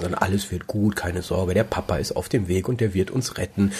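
put-on soft, calming talk to a child; reassuring parent. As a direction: soothe a scared child. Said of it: "So redet man dann nicht".

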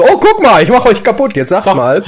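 high, loud gloating villain; mock villain. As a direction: gloat like a cartoon baddie. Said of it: "Und so".

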